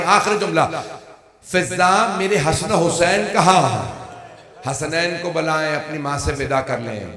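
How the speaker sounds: mourning sermon chant rising to its climax; lament preaching. Acted.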